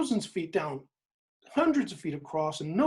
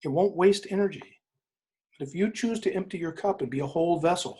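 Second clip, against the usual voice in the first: ordinary talk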